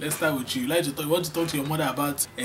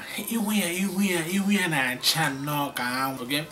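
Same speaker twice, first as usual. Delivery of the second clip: ordinary talk, nothing marked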